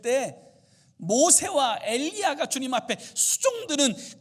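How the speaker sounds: loud, high, impassioned sermon delivery; fervent preaching. Acted. Preacher rousing the church, loud and fervent. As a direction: preach it out at full force to the congregation. Preacher builds to full force.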